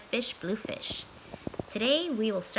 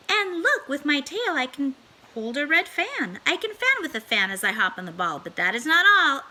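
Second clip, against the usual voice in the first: showing-off cat; 6 semitones higher than usual. Acted. Cat boasting to the children while balancing. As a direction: crow about every trick.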